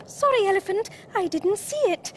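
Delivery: high-pitched little apology; high, contrite storybook Mole; apologetic Mole. A narrator's reading. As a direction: say sorry in a small, high animal voice.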